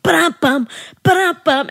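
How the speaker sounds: high sung war drum beat; dramatic music hum. Retold or imitated. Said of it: "has the super dramatic music".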